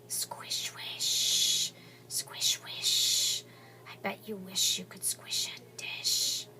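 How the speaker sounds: breathy unvoiced whisper of voices in his head. Zach's inner voices whispering to him. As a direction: hiss it like voices only he can hear. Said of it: "So when I read this whispering".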